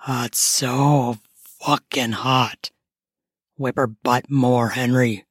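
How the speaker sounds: low male character voice; deep, drawn-out words from an aroused onlooker; Ben. Acted. Neighbor urges the husband on, low and lustful. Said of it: "said Ben".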